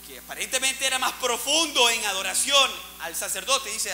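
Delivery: usual speaking voice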